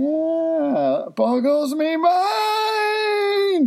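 very high, drawn-out wail; hammed-up catchphrase. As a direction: sing out mock amazement, slow and high. Performing the segment catchphrase.

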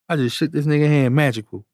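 ordinary talk, nothing marked

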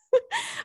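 squeaky rising laugh; a short high-pitched laugh. Real, not acted. Sudden laugh.

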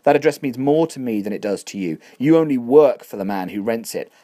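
builds from calm to a loud, offended outburst; indignant landlord. Acted. Landlord rebuking Catherine, growing loud and indignant.

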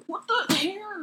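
heated yelling. Real, not acted. Arguing over charger.

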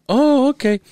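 high mock reply of the drunk lady.

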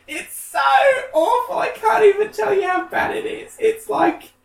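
very high, strained wail; exasperated squeal. Real, not acted. Telling the co-host how awful the film is, squealing in exasperation. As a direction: let the voice shoot up into an appalled squeal.

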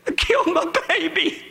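high, tearful imitated wail; crying. Girl in the clinic after abortion, crying as retold. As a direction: imitate a woman weeping out her confession. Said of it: "was crying".

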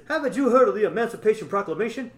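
lightly voiced Chef, high and loud. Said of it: "he says".

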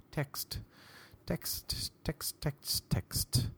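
hushed, repeated whisper; mock whisper. Man giving in to onlookers and texting, whispered. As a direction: whisper the word softly, over and over. Acting out texting instead of reading.

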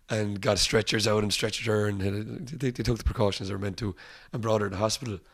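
ordinary delivery

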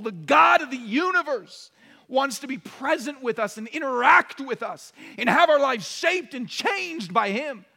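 loud, high passionate sermon climb; fiery preaching. Acted. Pastor thundering to the congregation about God's desire. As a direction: preach it out at full force.

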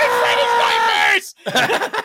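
high, drawn-out yell of panic; freaked-out shout. Retold or imitated. Kid flipping out at the TV, a slow panicked shout. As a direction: yell it like a kid who just got scared. Reliving his childhood fright.

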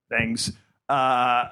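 ordinary talk

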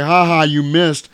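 imitating a jeering villain; mock taunt. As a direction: gloat like a cartoon villain. Villain taunts hero, acted.

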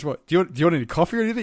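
fast, high, flustered babble; flustered surprise. Retold or imitated. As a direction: blurt it out startled. Flustered reply to bad news.

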